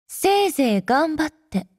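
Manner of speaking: usual speaking voice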